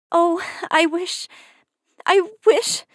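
high, tearful sobbing plea. A narrator's reading. Cinderella sobs to her fairy godmother, high and tearful. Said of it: "she sobbed".

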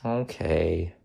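softly accepting okay; little smile. A narrator's reading. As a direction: give in quietly with a slight smile. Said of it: "said with a little smile".

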